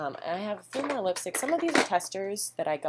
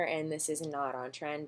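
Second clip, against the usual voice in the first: usual speaking voice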